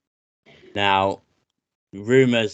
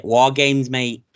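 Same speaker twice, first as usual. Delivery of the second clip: higher, louder Manchester accent; hammed-up Manc accent; 4 semitones higher, 6 dB louder, much slower than usual. Shown as a demonstration. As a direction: lay the Manc on thick. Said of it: "I'm just manking it".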